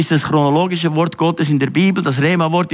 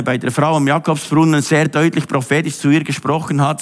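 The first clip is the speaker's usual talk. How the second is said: ordinary talk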